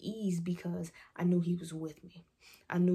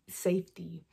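ordinary delivery

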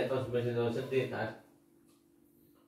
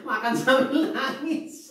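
laughing tease; 11 semitones higher, 4 dB louder, slower than usual. Real, not acted. Teasing mom crying while eating.

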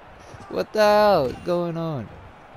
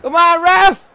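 high loud plea to the ref; pleading with the referee; 9 semitones higher, 6 dB louder than usual. Real, not acted. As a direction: beg the ref to count. Urging referee to count.

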